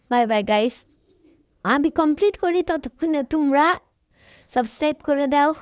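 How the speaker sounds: usual speaking voice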